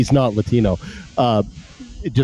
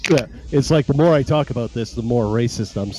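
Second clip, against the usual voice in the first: normal talking voice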